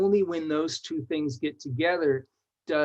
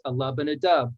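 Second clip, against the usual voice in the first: ordinary delivery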